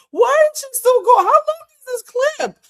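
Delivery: exasperated squeal at the endless clip; shocked disbelief. Real, not acted. Host asking chat why the clip won't end, high disbelief.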